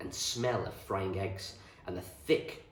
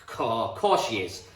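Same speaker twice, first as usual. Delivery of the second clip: hearty farmer; 8 dB louder, slower than usual. A narrator's reading.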